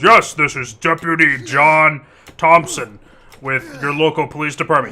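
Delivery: loud, slow fake American accent; stiff, drawn-out put-on American deputy. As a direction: imitate a scammer overdoing an American accent. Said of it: "he tries to fake his best American accent".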